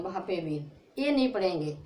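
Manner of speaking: ordinary talk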